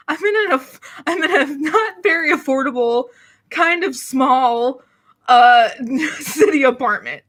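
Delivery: laughing through the words, slowing down; rueful laugh while admitting it. Real, not acted. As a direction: giggle out the complaint about the apartment. Laughing about pricey small apartment.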